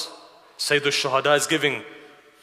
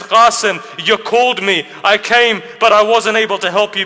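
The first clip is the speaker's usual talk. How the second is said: loud, high, grief-stricken cry to the fallen; anguished lament; 8 semitones higher, 9 dB louder than usual. Acted. Imam grieving over Qasim's body, anguished cry.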